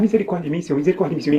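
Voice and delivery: ordinary talk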